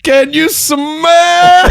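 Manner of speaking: high, slow, loud hammy wrestler voice; The Rock catchphrase. Retold or imitated. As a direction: drag it out like a wrestling promo. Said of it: "Channel the rock".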